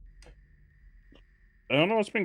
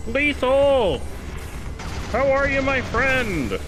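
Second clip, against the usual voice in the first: excited greeting; 11 semitones higher, slower than usual. Real, not acted. Greeting a viewer on stream.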